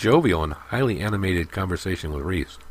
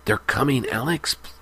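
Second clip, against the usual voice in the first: pleading; 7 semitones higher than usual. A narrator's reading. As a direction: beg him to stop. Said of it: "pleaded Lehman".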